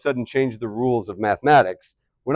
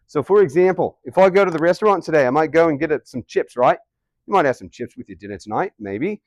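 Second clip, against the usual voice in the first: everyday Aussie with broad vowels; Australian accent; 3 semitones higher than usual. Shown as a demonstration. Teacher giving a restaurant example, in Australian accent. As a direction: chat like an Australian. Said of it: "here in Australia".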